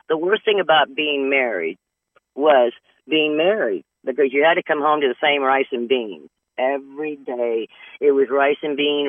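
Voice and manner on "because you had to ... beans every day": usual speaking voice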